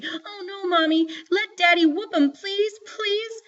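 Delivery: put-on very high, panicky child's plea; frantic begging. A narrator's reading. Joetta begs Mama to spare Byron, frantically. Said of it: "Joetta saw the Vaseline and went crazy".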